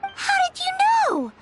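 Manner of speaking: high, startled question; caught off guard. Acted. Stunned that the businessman guessed, pitched high.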